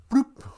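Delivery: high, popping bloop sound; bloop splash. Acted. Muskrat hits the water, high popping bloop. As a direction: make the little plop of a dive.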